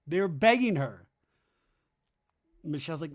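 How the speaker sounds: ordinary talk, nothing marked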